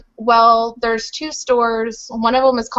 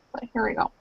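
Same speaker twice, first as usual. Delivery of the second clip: ordinary talk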